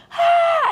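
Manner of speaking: very high toddler shriek, imitated; short high cry like a kid freaking out; mock freak-out scream. Nephew having a three-year-old tantrum, imitated scream. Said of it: "freaking out".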